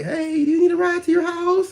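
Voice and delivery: put-on high, far-off falsetto young woman; imitating a young lady. Young woman offering the speaker a lift, high falsetto. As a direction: voice her high and sweet as she offers the ride. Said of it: "she was a young lady like".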